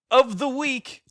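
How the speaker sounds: loud, high announcer flourish; award announcement. Pretend. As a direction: proclaim it like a grand prize.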